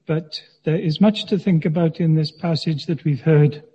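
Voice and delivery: ordinary talk, nothing marked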